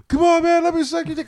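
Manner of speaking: high, pleading stranger voice; high pleading imitation of the stranger; stranger at stoplight.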